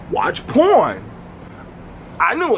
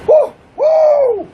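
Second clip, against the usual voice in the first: high-pitched whooping with delight; hammed-up high, loud pleasure whoops; man in ecstasy; 5 dB louder, much slower than usual. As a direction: whoop far above your own voice. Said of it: "he'll be like".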